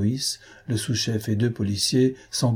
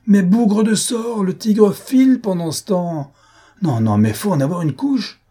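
foaming with rage; 10 semitones higher, 6 dB louder than usual. A narrator's reading. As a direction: burst out furiously at the fools letting the quarry escape. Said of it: "Il écumait de rage".

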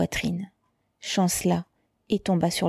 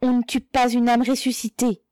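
higher-pitched, ringing defiance; defiant cry; 6 semitones higher, 3 dB louder than usual. A narrator's reading. Mother crying out at the spy, defiant. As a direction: cry it out with conviction. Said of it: "elle cria".

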